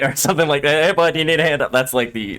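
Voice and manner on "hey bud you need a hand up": higher, mock-friendly Canadian offer; passive aggressive. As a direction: put on overly helpful Canadian politeness. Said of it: "that's my way of doing it".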